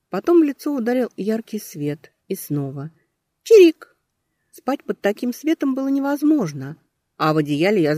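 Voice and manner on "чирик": squeaky chirp. Acted. Alarm bird keeps chirping.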